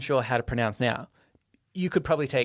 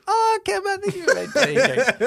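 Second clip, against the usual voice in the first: much higher, laughing protest; mock defensive; 4 dB louder than usual. Real, not acted. Host playfully pleading his case, high and laughing. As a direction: squeak out a defence.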